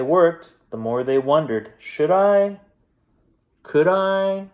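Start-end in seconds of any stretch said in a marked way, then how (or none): 1.8-4.5 higher, hopeful wondering; toying with the idea, lifted up; wondering aloud. Acted. The brothers wondering whether to ask for work.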